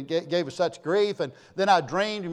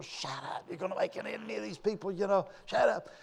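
much softer urgent hushing aside; hushed warning; 9 dB quieter than usual. Retold or imitated. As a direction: hush him across the table so nobody hears. Builder hushing him under table.

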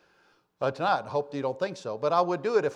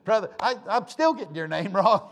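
laughing at himself; 6 semitones higher, 6 dB louder than usual. Real, not acted. Laughing at own name slip.

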